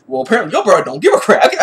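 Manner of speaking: ordinary talk, nothing marked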